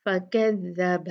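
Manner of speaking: फकज़्ज़ब with every letter light; slow light recitation. Shown as a demonstration. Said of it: "इतना प्यारा लगता है ना जब ऐसे पढ़ते हैं".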